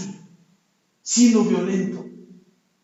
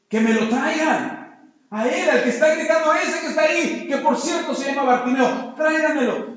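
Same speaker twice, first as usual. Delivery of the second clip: raised, higher insistent voice; insistent order; 5 semitones higher, 4 dB louder than usual. Acted. Jesús insists his disciples bring the blind man, insistently. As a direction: press the order on reluctant helpers.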